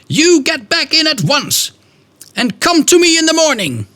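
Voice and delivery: very high, harsh, scolding matron; a strict matron catching a child out of bed; terrible lady's voice. Retold or imitated. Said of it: "a terrible lady's voice".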